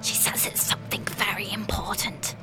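put-on soft, eerie little girl whisper. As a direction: breathe it like a secret. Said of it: "the girl whispered".

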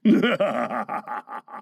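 low wicked chuckle; gloating pirate laughter; villain's laugh. Acted. Villain laughs at his plot.

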